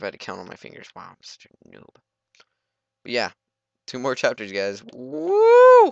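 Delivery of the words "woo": very high, loud whoop; excited high whoop; cheer. Real, not acted. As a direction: whoop with delight. Cheering two chapters left.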